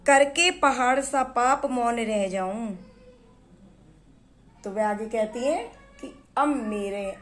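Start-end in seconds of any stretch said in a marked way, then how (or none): 0.1-2.8 poem recitation. Acted. Recites verse line.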